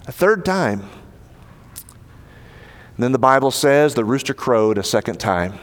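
normal talking voice

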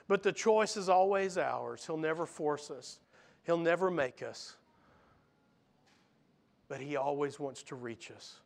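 usual speaking voice

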